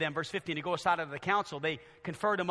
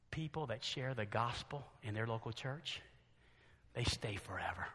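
very soft, low, sobering tone; low, confiding pulpit voice; hushed preaching; 6 semitones lower, 10 dB quieter than usual. Real, not acted. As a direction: bring it right down to confront them quietly.

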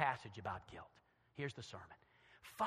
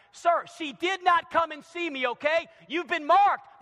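raised, forceful correction; loud rebuke; 11 semitones higher, 12 dB louder than usual. Acted. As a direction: set the husband straight at full volume. Preacher rebukes the accusing husband.

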